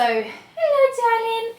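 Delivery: very high sweet puppy talk. Real, not acted. Greeting dog.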